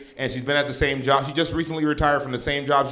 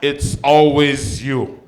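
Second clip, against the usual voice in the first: mom's punchline; 3 semitones higher than usual. Retold or imitated. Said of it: "She goes".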